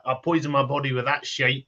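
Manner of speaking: badly imitated Scottish parody; terrible Scottish accent. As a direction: put on a rough Scots accent. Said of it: "That's my terrible Scottish accent".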